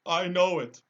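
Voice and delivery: a big cowardly lion protesting; lion, high and loud. Acted.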